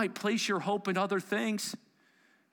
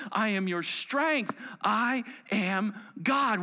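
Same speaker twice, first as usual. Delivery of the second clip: higher, louder litany; preaching at full force; 6 semitones higher, 3 dB louder than usual. Real, not acted. Preacher proclaiming God's names to the congregation, forceful. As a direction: thunder out each I am.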